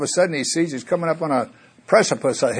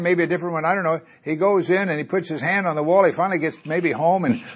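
normal talking voice

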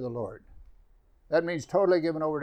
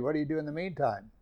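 ordinary delivery

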